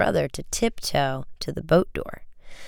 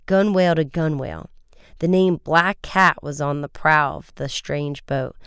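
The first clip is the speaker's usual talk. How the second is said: ordinary delivery, nothing marked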